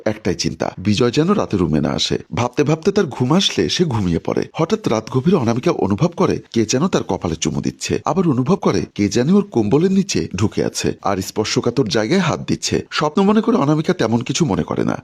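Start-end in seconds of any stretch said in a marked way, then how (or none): none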